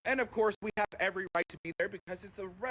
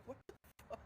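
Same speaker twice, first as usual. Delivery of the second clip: unvoiced, breathy What the fuck; mouthing it in shock to a friend; hushed aside at the table; whispered disbelief; 5 semitones higher, 17 dB quieter, much faster than usual. Silent look between friends.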